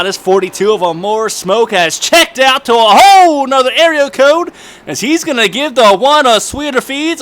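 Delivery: excited high race call; play-by-play. Acted. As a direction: call the race live, building up.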